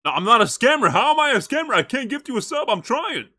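exasperated denial to chat; indignant protest. Real, not acted. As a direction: push back hard on the accusation. Defending against scammer accusations.

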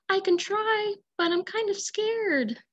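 nervous but willing puppet; scared chicken. Acted. Chicken admits she's scared.

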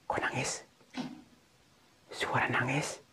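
very soft, voiceless murmur; hushed, uneasy aside; spooked whisper. Real, not acted. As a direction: whisper warily, as if listening. Hears crying in the dark.

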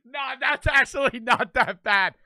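loud, high, laughing words; cracking up mid-sentence; real laughter. Real, not acted. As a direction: talk through a burst of laughter. Laughing at the igloo wish.

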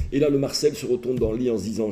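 ordinary talk, nothing marked

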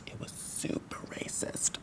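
hushed office gossip; whispered gossip. Real, not acted. Trainer whispering gossip about a coworker across the room. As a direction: whisper so she can't hear you.

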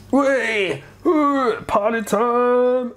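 high, slow, giddy party whoop; party cheer. Retold or imitated. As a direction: whoop it up like a reveller. Cells voiced partying.

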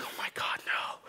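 whispered dread; panicked whisper. Retold or imitated. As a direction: breathe it out in horror. Dread as mom arrives.

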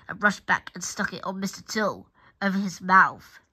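ordinary delivery

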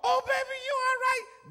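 high-pitched imitation of a doting mother; mock a mama cooing over her fallen baby; fussing mama. Fussing mother voiced in story.

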